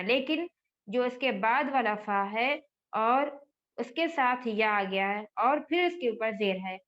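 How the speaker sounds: ordinary talk, nothing marked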